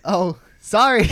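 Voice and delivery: loud, high, drawn-out fake sorry; mock apology. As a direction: stretch out an insincere apology. Retold mock apology after her outburst.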